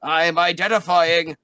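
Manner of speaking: hammed-up magic trance voice; drawn-out identifying. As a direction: stretch it out like a trance. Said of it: "He's like".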